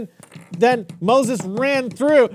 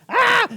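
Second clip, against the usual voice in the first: very high mock attack yell; mock battle cry; 14 semitones higher than usual. Uncle acting Pharaoh charging, mock yell. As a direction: yell as you swing the sword.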